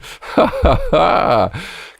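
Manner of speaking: high-pitched gleeful laugh; giddy, high laughter; delighted laugh. Real, not acted.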